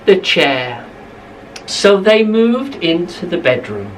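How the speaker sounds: usual speaking voice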